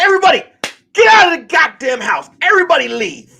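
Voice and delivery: high, loud order to clear out; acted-out yelling at a roomful of people; retold angry shout. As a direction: shout it like kicking everyone out. Throwing everyone out in anger.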